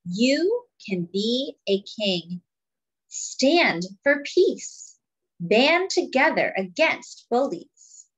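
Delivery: slow, weighty refrain then lively lines; storybook reading with a slow refrain; reading to children. Acted. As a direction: read the book aloud to the class. Reading picture book to children.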